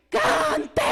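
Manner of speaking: sudden high, loud command; one-word order shouted high and loud; priest's shout. Retold or imitated. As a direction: bark it out like the priest on the altar. Priest orders them to sing.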